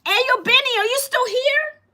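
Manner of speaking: excited call-out. Real, not acted. Calling out to a viewer on live.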